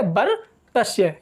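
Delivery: बर्तस्य spelled out slowly as बर्-त-स्य; teacher slowly modelling बर्तस्य; slow careful pronunciation. Shown as a demonstration. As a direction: slowly model बर्तस्य, each part distinct. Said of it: "इसका सही उच्चारण जो होगा वो बर्तस्य होगा".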